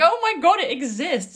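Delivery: high, loud gasp of delighted surprise; mock amazement. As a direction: squeal it like you just found an old friend. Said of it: "I was like".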